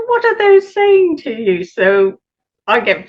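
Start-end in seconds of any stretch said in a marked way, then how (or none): 0.1-1.6 high, lilting imitation of the teacher; teacher's probing question. Australian teacher asks student about chosen bottles, high and lilting. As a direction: voice the teacher, pitched well up.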